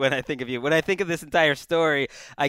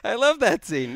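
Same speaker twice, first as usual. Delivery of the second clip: insistent; 8 semitones higher than usual. Real, not acted. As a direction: push the love of that scene. Cohost insists, louder and higher.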